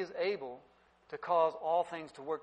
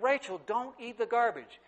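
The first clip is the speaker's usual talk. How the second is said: put-on higher, firm parent warning to a little girl; warning a toddler; 7 semitones higher than usual. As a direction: tell the baby no, sharply. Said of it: "I said".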